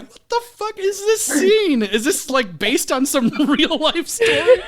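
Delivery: high, slow, incredulous; watching the bizarre deleted scene, amazed; baffled disbelief. Retold or imitated. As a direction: react in shocked disbelief. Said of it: "And I was like".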